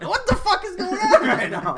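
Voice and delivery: high, loud mock panic through laughter; retold alarmed disbelief. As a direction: squeal in exaggerated disbelief, amused.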